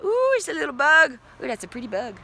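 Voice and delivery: high, loud excited ooh; charmed by a bug; delighted surprise. Real, not acted. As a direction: gasp over a ladybug. Spots a bug on camera.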